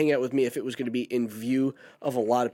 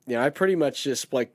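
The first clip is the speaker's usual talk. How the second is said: ordinary delivery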